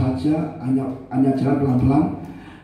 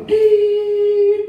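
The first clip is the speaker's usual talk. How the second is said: long high electronic beep imitation; high held machine beep; monitor beep; 5 dB louder than usual.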